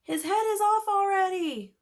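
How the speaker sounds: put-on high, loud kid voice; yelled. A narrator's reading. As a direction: shout the obvious. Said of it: "somebody yelled".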